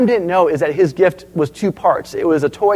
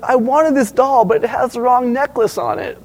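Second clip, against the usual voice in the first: put-on whining crying child; 6 semitones higher than usual. Child complains to mom about a Christmas doll, mock whining. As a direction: imitate a tearful kid complaining.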